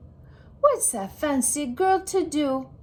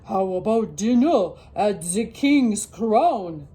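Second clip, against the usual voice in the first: dad voice; 2 semitones lower, 4 dB louder than usual. Acted. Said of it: "Dad suggests".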